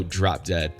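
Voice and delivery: ordinary talk, nothing marked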